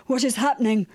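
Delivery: mild, bewildered cry; understated alarmed call. A narrator's reading. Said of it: "cried the blind man".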